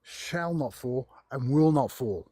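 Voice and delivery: ordinary talk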